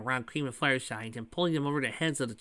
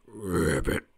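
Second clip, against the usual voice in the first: much lower croaking ribbit; frog croak; 8 semitones lower than usual. Acted. Froggy agreeing with Big, low croak. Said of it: "gave a croak in agreement".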